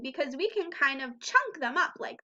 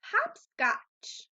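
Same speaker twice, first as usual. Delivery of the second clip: Hopscotch said higher, clearly split into hop and scotch; model word; 7 semitones higher than usual. Shown as a demonstration. Teacher reads Hopscotch to children, high and clear. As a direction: Hopscotch with a high start and falling end. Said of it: "listen carefully to my words".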